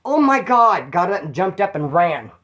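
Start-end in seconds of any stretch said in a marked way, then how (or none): 0.0-0.9 higher, louder oh my God; mock mortified wife; wife realizing, a touch of embarrassment; embarrassed gasp. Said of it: "The wife went".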